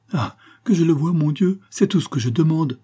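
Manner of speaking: high, imploring outburst; heartfelt plea, pitched well up; eager pleading. A narrator's reading. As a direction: implore with sudden eager hope. Countess pleads to see husband.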